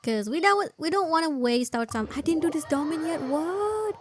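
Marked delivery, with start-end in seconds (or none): none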